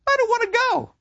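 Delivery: very high, whiny refusal; reluctant boy. Retold or imitated. Kemerick refusing to enlist, high and whiny. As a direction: whine like a scared kid.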